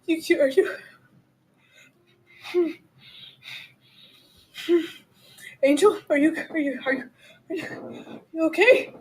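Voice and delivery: high, stammering, halting voice; small scared voice, tentatively checking on someone; timid, frightened. Acted. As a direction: falter over the question, fading toward a whisper. Frightened Kim checks on Angel.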